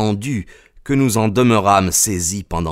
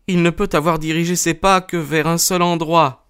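high-pitched female character voice; high woman's voice, revealing a secret; wronged wife; 9 semitones higher than usual. A narrator's reading. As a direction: answer earnestly in a high voice. Narrator voices Mrs Stapleton.

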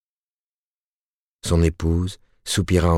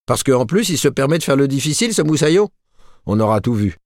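much higher, louder, fast mocking scorn; sneering; 9 semitones higher, 3 dB louder than usual. A narrator's reading. Husband mocking his wife's brother, sneering. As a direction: scoff at the sailor boy. Husband mocks the fussy sailor.

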